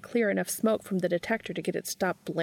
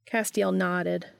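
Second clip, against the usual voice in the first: usual speaking voice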